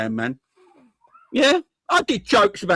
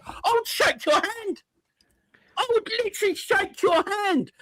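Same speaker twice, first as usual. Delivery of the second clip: eager to accept; 3 dB quieter than usual. Acted.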